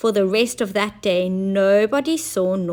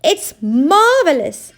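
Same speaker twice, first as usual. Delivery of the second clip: higher, louder delight; Munching Mike exclaiming, high and loud; 7 semitones higher, 7 dB louder than usual. A narrator's reading. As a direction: gasp with wonder. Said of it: "exclaimed Munching Mike".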